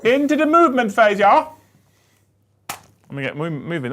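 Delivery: mock German or Dutch accent. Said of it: "That's my best German or Dutch accent".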